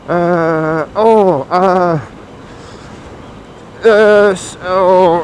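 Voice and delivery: halting, drawn-out searching noises; struggling to remember. Acted. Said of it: "trying to remember his French".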